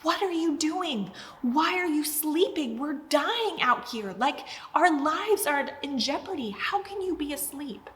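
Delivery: high, pleading panic at a sleeping Jesus; panicked disciples. Retold or imitated. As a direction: cry out like frightened fishermen in a storm. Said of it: "they're like".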